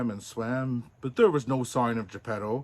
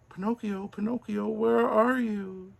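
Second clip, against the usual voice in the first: high, distant calling over the waves; a faint far-off cry; 10 semitones higher than usual. Acted. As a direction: call his name across the sea. Father calls from the sea.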